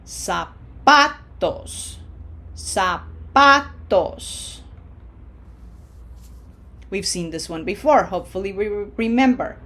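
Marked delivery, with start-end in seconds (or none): 0.0-4.6 "Zapatos" very slow, initial Z said as a hissed S. Shown as a demonstration. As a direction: break "Zapatos" into sa-PA-tos, middle syllable stressed. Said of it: "no Z sound in Spanish, just like an S".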